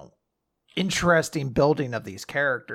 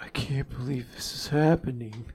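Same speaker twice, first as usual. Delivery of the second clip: soft breathless whisper of wonder; whispered disbelief; 5 dB quieter than usual. Retold or imitated. Co-host mimics the boy's stunned thrill in a whisper. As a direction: whisper it like you can't believe your luck. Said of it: "it's a very cute thing to be like".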